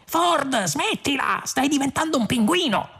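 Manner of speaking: high, urgent plea; calling out. A narrator's reading. Arthur calling to Ford who is turning penguin, alarmed. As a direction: cry out in high-pitched alarm. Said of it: "chiamò poi".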